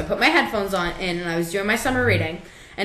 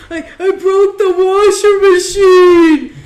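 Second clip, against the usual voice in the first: high, loud, drawn-out blubbering; mock crying wail; 10 semitones higher, 11 dB louder, slower than usual. Sister imitating her brother confessing upstairs, hammed-up crying. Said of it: "and he's crying".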